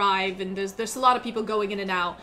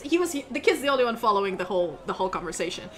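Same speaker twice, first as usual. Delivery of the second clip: usual speaking voice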